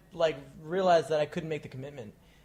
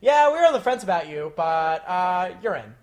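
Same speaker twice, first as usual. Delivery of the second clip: higher, louder, no emotion whatsoever; Craig impression; 3 semitones higher, 10 dB louder than usual. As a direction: deliver it with zero enthusiasm. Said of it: "he literally said verbatim".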